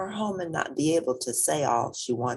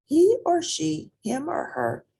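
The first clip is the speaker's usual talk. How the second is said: grandma explaining; 4 dB louder than usual. Acted.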